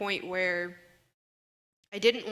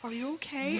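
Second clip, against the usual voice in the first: higher-pitched retold question of concern; concerned seatmate; 7 semitones higher than usual. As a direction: lightly imitate a worried stranger checking in. Retold worried seatmate asking her.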